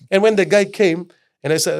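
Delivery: ordinary talk, nothing marked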